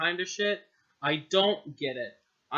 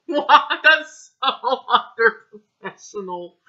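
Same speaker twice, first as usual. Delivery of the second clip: much higher, louder laughing shock; laughing disbelief; 6 semitones higher, 12 dB louder than usual. Real, not acted. Reader laughing at the bad line. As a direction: crack up in disbelief. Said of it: "I am crying at this".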